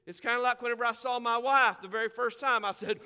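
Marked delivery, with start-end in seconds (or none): none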